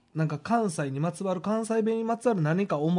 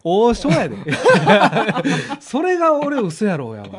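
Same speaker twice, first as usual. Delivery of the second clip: laughing, louder, protesting between laughs; 6 semitones higher, 6 dB louder than usual. Real, not acted. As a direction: laugh through the protest that it's no big deal. Friend doubts his Osaka Ohsho trip. (笑いながら抗議)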